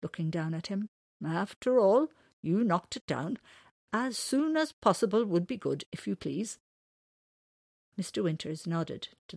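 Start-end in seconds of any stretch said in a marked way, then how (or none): 1.2-6.6 lightly voiced owl, higher; a hint of a stately old owl; wise old owl. Owl reminds man he broke the fence, lightly voiced owl. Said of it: "said the wise old owl".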